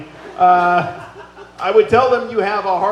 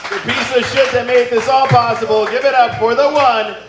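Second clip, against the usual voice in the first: rising higher and louder to a peak on the name; building hype intro; 6 semitones higher, 4 dB louder than usual. Real, not acted. Emcee hyping the crowd for the man of the hour, building to a loud high call. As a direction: work the crowd up to the big reveal. Host hyping the main roastee.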